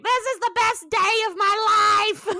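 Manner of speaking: very high, loud, hammed-up joy; mock-ecstatic squeal in a put-on voice; Bobby overjoyed. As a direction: shriek it like an ecstatic kid.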